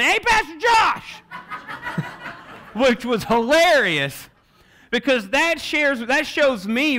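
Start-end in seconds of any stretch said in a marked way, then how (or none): 0.0-1.2 high drawn-out shout across a parking lot; calling out. Retold or imitated. Tony calls to the pastor from his car, loud high shout. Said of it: "honk the horn, and say". 1.3-2.7 breathy laugh. Real, not acted. Laughing at his own story. 2.7-4.3 laughing. Real, not acted. Laughing while telling.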